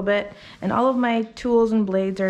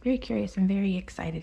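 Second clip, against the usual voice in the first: hushed, breathy aside; soft whisper; 6 dB quieter than usual. Real, not acted. Groomer whispering about the curious dog, hushed. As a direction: whisper so the dog stays calm.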